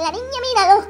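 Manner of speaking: usual speaking voice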